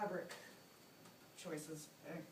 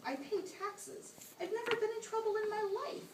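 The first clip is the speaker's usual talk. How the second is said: desperate pleading; 12 semitones higher than usual. Acted. Prisoner begging the ghost for rescue, high and panicky. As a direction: beg as your voice climbs out of control.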